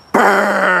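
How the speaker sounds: sudden very loud, high buzzing blare; startling loud imitation of a machine roaring; imitated generator roar. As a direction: blast out the noise of a generator kicking on. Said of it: "This loud fucking noise".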